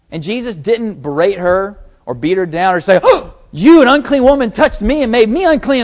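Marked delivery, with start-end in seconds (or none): 3.0-5.8 loud, high sneering rebuke; mock self-important scolding; haughty scolding. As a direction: look down your nose and scold her. Mocking Jesus berating the woman.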